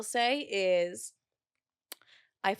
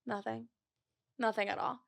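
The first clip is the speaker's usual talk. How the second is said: much softer, sulking murmur; sulky quiet; 8 dB quieter than usual. Retold or imitated. Telling her mom nothing is wrong, quiet sulk. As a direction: mumble it like you're sulking. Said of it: "I was just quiet".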